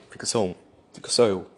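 building to a loud shout; emphatic shout. Real, not acted. Shouting at whiny listeners, building loud. Rant against complaining listeners.

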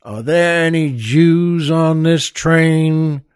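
slow, deliberate question; tough Texan demand. Acted. Texan gunman asking the frightened passengers, slow and deliberate. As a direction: spell it out slowly, word by word. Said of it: "And he says".

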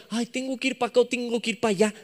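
dithering self-questioning. Acted. Person wondering which way to go, quick mock dithering. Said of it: "Ya no te preguntarías".

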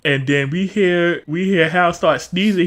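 usual speaking voice

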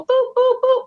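high mock beeps; high beeping boops. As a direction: squeak each boop high like button presses. Others pushing her buttons.

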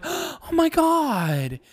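high, very slow breathy gasp; imitating a dramatic gasp, high and drawn out; gasped exclamation. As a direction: play it like a heartbroken shock. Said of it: "like gasps".

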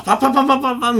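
rapid high pa-pa-pa burst; fast, high mouth percussion; imitated drumming. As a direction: rattle it off like a toddler banging. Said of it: "三歳児がなんか叩いてるみたいな".

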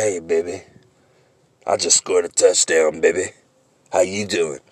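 lightly mocking seductive pickup line; smooth flirty greeting. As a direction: ease it out like a player flirting. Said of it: "the way you said it".

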